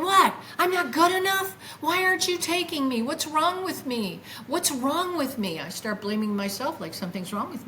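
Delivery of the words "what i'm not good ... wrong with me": high, mock-screamed protest that eases off; retold screaming. Rejected by the extraterrestrial, retold screaming protest. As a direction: protest in a high, wounded cry. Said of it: "so I'm screaming".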